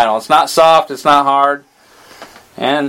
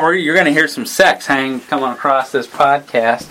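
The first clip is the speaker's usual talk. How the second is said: usual speaking voice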